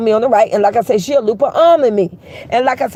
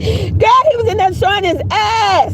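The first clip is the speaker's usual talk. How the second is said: indignant exclaim; 7 semitones higher than usual. Real, not acted. Indignant retelling to viewers.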